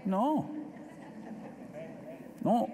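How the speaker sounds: ordinary talk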